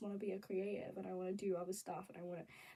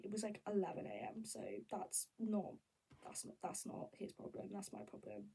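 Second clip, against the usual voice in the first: ordinary delivery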